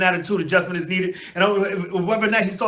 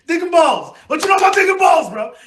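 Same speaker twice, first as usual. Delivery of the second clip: much higher and louder, hollering it; excited shout; 12 semitones higher, 6 dB louder than usual. Real, not acted. Fan on his vlog hyping the play's nickname, loud shout. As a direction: yell the nickname like a proud fan.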